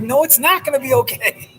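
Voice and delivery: losing it. Retold or imitated. Cyclist snapping back at his client in retold panic. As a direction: push back in near-yelling alarm, stress on not. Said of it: "I wasn't quite yelling, but I did kind of lose it".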